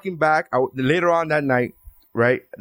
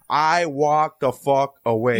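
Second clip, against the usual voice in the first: ordinary talk, nothing marked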